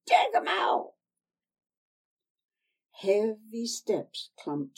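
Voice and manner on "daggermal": much higher, louder screeching hag. Acted. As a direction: screech it up into the rafters. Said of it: "screeched up into the rafters".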